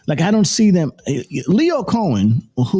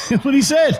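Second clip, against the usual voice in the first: laughing. Real, not acted. Laughing at the quoted remark.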